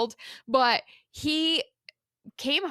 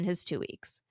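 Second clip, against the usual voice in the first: whisper; 5 semitones lower, 7 dB quieter than usual. Real, not acted. Dramatic reveal.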